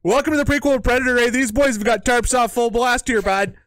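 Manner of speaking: high, loud, fast hammed-up Canadian accent; a half-sung Canadian hype pitch, bud. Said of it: "with my Canadian voice the whole time".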